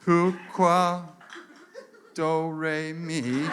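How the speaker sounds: drawn-out fake French with long gaps; slowed down. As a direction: patiently stretch each nonsense word. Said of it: "I'll slow it down for you".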